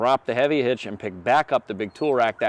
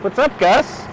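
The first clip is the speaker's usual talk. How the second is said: talking to a dog; 9 semitones higher than usual. Real, not acted. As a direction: call out to the dog like a happy owner. Greeting the dog arriving.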